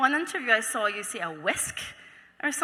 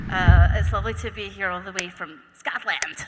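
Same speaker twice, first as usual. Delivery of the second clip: normal talking voice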